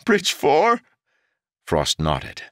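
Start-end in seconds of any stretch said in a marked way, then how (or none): 0.1-0.8 anguished cry. A narrator's reading. Jarek hears his men died.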